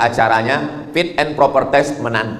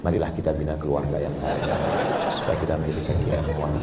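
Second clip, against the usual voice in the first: much softer, hushed and quick, a put-on gentle tone; mock gentle voice; 9 semitones lower, 10 dB quieter, faster than usual. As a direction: pretend to speak softly and sweetly. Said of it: "udah setel ngomong itu lembut". (suara lembut yang dibuat-buat, pelan sekali)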